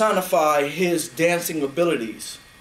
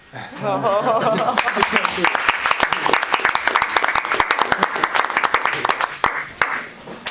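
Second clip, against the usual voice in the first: laughing. Real, not acted. Giggling at the story.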